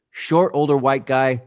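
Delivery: ordinary talk, nothing marked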